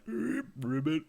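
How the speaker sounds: imitated frog ribbit; toad croak. Host imitating how Toad should sound, froggy croak. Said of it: "Toad would be like".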